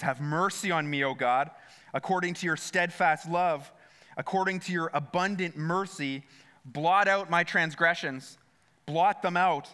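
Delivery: normal talking voice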